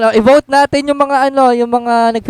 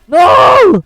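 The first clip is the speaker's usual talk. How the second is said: sharp, loud, higher no; shouted no; 8 semitones higher, 6 dB louder than usual. Real, not acted. Yelling at a teammate heading for danger, loud and high. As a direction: yell to stop him.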